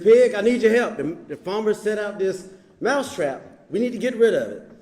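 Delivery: high, loud, urgent begging; pleading mouse. Acted. Mouse in the fable begging the pig, high and loud. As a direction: plead for help at full volume.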